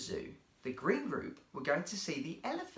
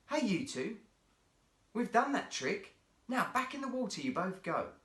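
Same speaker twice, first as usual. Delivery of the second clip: lightly voiced keeper; 8 semitones higher than usual. A narrator's reading. Keeper scolding the seals.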